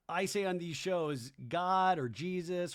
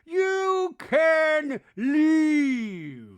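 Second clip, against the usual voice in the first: high, loud, hugely stretched words; over-the-top slow imitation; Meredith; 11 semitones higher, 11 dB louder, much slower than usual. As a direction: drag out each word in a mocking wail. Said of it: "Meredith's voiceover goes".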